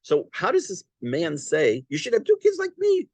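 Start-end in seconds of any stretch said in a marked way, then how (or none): 1.9-3.1 high smug mocking of "two kids like me"; mock boast. Teacher mocks judge's boast to class, high and smug. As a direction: poke fun at the boast.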